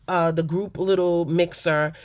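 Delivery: ordinary talk, nothing marked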